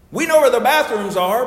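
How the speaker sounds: high, loud pulpit punch; preaching. Real, not acted. Preacher reminds members what they already know, emphatic preaching. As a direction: belt it out to the congregation.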